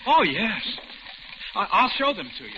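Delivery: put-on light up like a proud child; gushing, stammering excitement; eager boast. Captor proudly shows collection.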